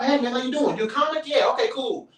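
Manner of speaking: high, friendly greeting; friendly comic. Retold or imitated. Cool comic greeting a newcomer, high and friendly. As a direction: chat you up like a cool fellow comic. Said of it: "the ones that's cool people".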